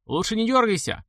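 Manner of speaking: sharp warning shout. Acted. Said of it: "крикнул".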